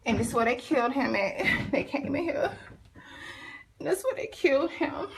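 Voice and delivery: shaky laugh through grief; laughing in disbelief, voice rising; nervous laugh. Real, not acted. Laughs while showing killing spot.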